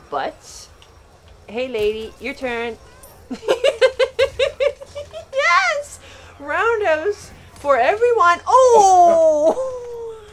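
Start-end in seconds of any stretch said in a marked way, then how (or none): 3.3-5.3 long, high staccato laugh; loud high-pitched cackling in pulses; gleeful cackle. Real, not acted. Player kicking enemies' butts, gleeful cackle. 5.3-10.2 loud, high, gleeful shouting; triumphant yell. Real, not acted. Player kicking all these guys, triumphant yell.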